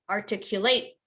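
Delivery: ordinary talk, nothing marked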